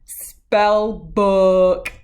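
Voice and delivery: loud on spell book; horrified. A narrator's reading. Cat horrified at the spell book. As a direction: land spell book in dismay. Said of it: "he realized with horror".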